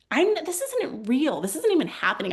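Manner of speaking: real agitation, high at first; getting worked up. Real, not acted. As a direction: catch yourself getting riled, then settle. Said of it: "I'm starting to get worked up".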